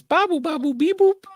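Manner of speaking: high droid chirps and bleeps; R2-D2 beeps. Retold or imitated. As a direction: chirp it out like a little robot. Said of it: "then he goes".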